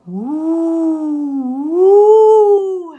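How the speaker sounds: rising, loud hooting howl; howling wind. Acted. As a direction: howl it like a gust. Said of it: "howled the wind".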